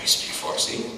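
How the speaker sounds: barely voiced wondering aside; soft acted whisper to himself; hushed realization. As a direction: say it under your breath, as if just realizing. Acting out his dawning realization.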